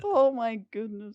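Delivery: high, loud shocked cry; shocked outburst. Real, not acted. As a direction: gasp it out in disbelief.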